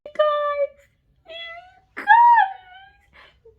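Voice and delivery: very high, drawn-out whine of excitement; overwhelmed squeal. Real, not acted. Reader readies viewers for Bane's big gesture, emotional squeal. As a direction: squeal it long, nearly crying. Overcome before shaving reveal.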